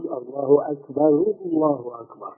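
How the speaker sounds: quiet الله أكبر, the ending of أكبر kept brief; soft correct call. Shown as a demonstration. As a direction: say الله أكبر softly and cleanly. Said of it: "حتى تتأكد بتقول". (الله أكبر بهدوء ونطق سليم)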